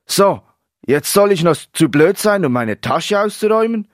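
loud, high, indignant retort; irritated outburst rising high; darkened temper. A narrator's reading. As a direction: throw it back, incensed. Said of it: "Marlons Laune verfinsterte sich weiter".

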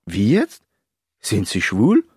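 puzzled questions swinging up at the end; taken-aback interviewer; confused. A narrator's reading. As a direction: ask in bewildered disbelief. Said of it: "war verwirrt und fragte".

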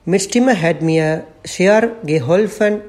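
usual speaking voice

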